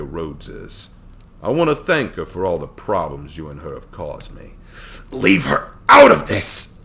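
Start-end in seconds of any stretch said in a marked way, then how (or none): 5.1-6.7 shouted, slow and much louder; angry shout. A narrator's reading. Hero shouts at villain to leave Victoria out, angry shout. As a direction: bark it out to protect her.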